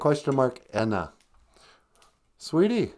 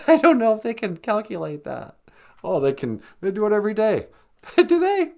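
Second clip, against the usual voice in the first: squeaky high voice; 9 semitones higher than usual. Acted. Sidekick voice laughing.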